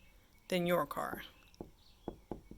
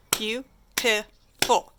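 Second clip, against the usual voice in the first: "Beautiful" in three loud, separate beats; clapped-out syllables; 2 semitones higher, 8 dB louder, much slower than usual. Shown as a demonstration. Teacher clapping out syllables for the class, separated beats. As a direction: punch out "Beautiful" slowly, syllable by syllable. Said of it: "Or clap it out".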